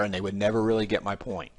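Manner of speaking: ordinary talk, nothing marked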